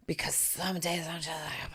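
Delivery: breathy confiding whisper; a secret whispered to listeners. Real, not acted. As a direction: lean in and whisper it. Whispered aside.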